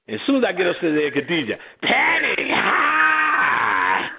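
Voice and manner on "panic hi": calling out. Retold or imitated. Calling up the stairs to him.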